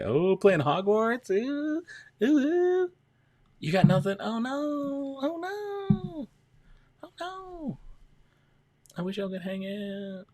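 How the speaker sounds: very high, drawn-out singsong; high-pitched cooing. Real, not acted. Streamer sorry viewers can't hang out, high cooing. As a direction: coo and lament in a squeaky high voice. Crooning reactions to viewers' chat messages.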